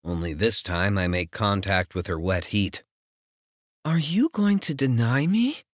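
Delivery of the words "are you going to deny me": higher, coy and taunting; teasing heroine voice. Acted. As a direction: play the coy heroine, pitched up.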